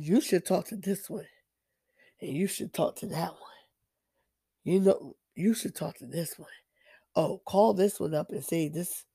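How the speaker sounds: sly half-whispered temptation; enemy whispering. Retold or imitated. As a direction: murmur it like a devil in the ear. Enemy whispering in their ear.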